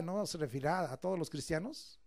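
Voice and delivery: ordinary talk, nothing marked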